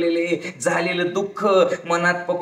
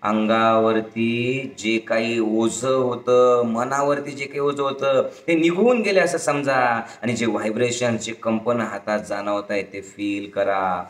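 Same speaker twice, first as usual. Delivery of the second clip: low, drawn-out meditation voice; guided meditation; 8 semitones lower than usual. Shown as a demonstration. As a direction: guide them slowly into feeling the release. (संथ, खालच्या स्वरात ध्यान मार्गदर्शन)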